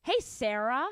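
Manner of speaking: imitated Canadian pronunciation, rising at the end; Canadian accent. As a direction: greet her like a Canadian. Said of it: "Or a Canadian goes".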